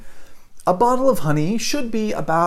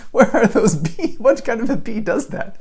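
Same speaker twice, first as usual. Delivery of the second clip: mock disbelief; 8 semitones higher than usual. Wife mocks the merchant's bee story with teasing disbelief. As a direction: poke fun at the silly price. Mocking the price.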